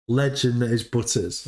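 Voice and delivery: ordinary talk, nothing marked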